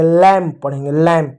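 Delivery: लैम ending on m, no b; लैम with silent b. Shown as a demonstration. Teacher gives pupils the right लैम. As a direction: model लैम cleanly, b silent. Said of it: "इसको लैम्ब नहीं पढ़ेंगे".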